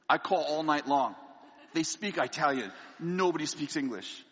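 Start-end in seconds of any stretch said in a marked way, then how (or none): none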